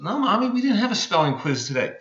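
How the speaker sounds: put-on high, quick child voice; little girl. Little girl correcting her mom, high and quick. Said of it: "And the little girl goes".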